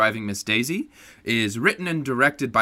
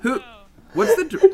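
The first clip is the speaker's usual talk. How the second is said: alarmed surprise; 4 dB louder than usual. Real, not acted. Alarmed question.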